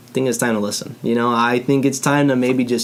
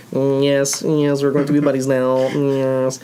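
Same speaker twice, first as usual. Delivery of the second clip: drawn-out, otherworldly alien voice; slow alien voice; slower than usual. Pretend. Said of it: "That's my alien impression".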